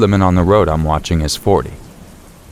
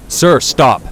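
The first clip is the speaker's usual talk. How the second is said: urgent yell; 8 semitones higher than usual. A narrator's reading. Officer yelling at charging man, higher urgent shout. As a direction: bark it out as he charges. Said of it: "I yell as the man rushes me".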